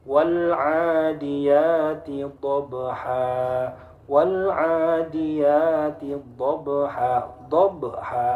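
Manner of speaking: WAL ADIYATI DHABHA chanted slowly, B bounced as 'dhob-be-haa'; bouncing qalqalah. Shown as a demonstration. Teacher recites ba example, slow bouncing qalqalah. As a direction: echo the B in DHABHA, long vowels held. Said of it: "Ba-nya memantul".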